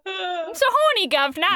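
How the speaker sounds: mock-lusty Cockney accent. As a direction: do a jokey Cockney suitor. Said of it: "Cockney accent".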